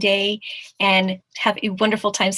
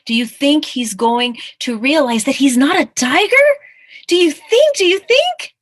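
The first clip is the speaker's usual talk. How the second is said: loud question climbing ever higher; mounting excitement; 7 semitones higher, 10 dB louder than usual. Real, not acted. Reader urging children to guess the ending, increasingly loud and excited. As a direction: build to a high, eager repeat.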